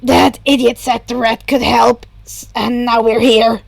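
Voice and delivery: a villain awkwardly admitting defeat; gruff Shigaraki. A narrator's reading. Shigaraki continues.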